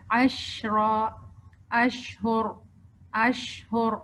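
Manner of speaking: انشاءاللہ, شئتم and عشرا with the ش held long and spread, very slowly; slow tajweed demo. Shown as a demonstration. Teacher slowly demonstrating the spread sh in example words. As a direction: drag out each ش so the air spreads. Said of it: "شین ویسے بھی تھوڑا سا time لیتا ہے".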